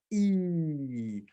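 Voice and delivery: E stretched as a long sound; teacher modelling the long vowel E; long E demo. Shown as a demonstration. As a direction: draw out E slowly. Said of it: "Once more".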